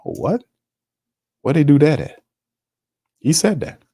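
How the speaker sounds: can't believe what he heard; shocked disbelief. Real, not acted. Host to chat, stunned by the coach's quote, high disbelief.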